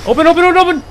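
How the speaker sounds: panicked pleading. Real, not acted. Begging a slow door to open.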